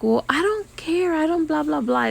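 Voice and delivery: high mock-snooty client voice; imitating the client. Nail tech imitating a rude client on the phone, mock snooty. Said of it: "she's talking to someone and she's like".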